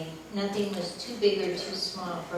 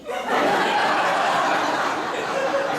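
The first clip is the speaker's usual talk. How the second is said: much higher, louder burst of laughter; 10 dB louder than usual. Real, not acted. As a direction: whoop with laughter. Laughs at skirt story.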